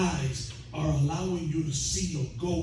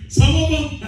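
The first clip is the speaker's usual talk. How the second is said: normal talking voice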